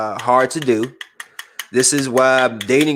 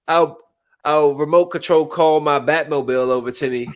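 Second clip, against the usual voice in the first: normal talking voice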